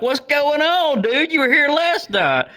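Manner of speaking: high, incredulous mock question; high-pitched baffled ribbing of a stranger; puzzled disbelief. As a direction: play it up like you can't believe he's back. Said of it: "And I'm like".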